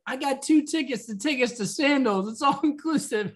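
high eager Michael pitch; selling the trip, voice up high; 1 lightly voiced Michael Scott. Michael pleading with Carol, a high hopeful plea.

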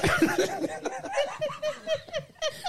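high laugh. Real, not acted. Helpless laughter after accent.

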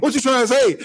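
high, loud affronted question; demanding the doubting woman explain herself, affronted and loud; offended challenge. Retold or imitated. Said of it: "And I was like".

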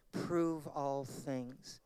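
very slow, each word set apart; slow solemn command. Acted. Preacher urging listeners to test teaching, slow and solemn. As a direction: lay down "Prove all things" deliberately.

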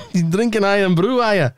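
put-on Scottish questioning; mock Scottish; Scottish accent. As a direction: check on him as a Scot.

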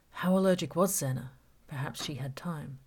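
plain reading voice

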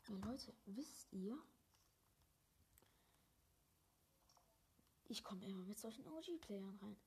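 breathy whisper to the viewers; real hushed aside. Real, not acted. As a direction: whisper it so nobody else hears. Whispering so teammate can't hear.

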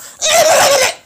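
high burst of laughter; laughter spilling out high and loud; loud shrieking laugh. Real, not acted. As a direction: throw back a big cackle. Loud laugh after joke.

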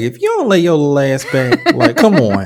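laughing through a scolding; laughing mock scold. Imagining scolding the scared girl, laughing. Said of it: "I was like".

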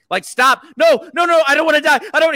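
loud, high pleading for his life; panicked begging. Retold or imitated. Retelling the film, begging his lover to stop. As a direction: beg her to stop as if your life depends on it. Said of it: "He's, like, begging for his life".

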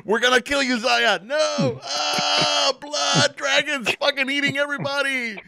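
squeaky cartoon voice; high silly voice. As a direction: pitch it way up, goofy. Podcast intro skit voices.

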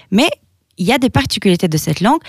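usual speaking voice

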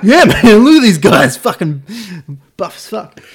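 loud, high excited bro voice; enthusiastic gym bro. Retold or imitated. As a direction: hype up the buff guys. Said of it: "He's like".

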